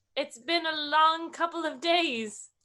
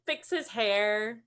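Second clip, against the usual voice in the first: ordinary talk, nothing marked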